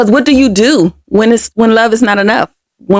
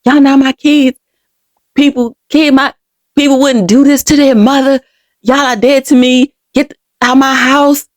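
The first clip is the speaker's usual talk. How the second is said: retold angry rejection, pitched higher; mother lashing out; 5 semitones higher than usual. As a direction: imitate a mother disowning her kids, moderately heated. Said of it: "she was like".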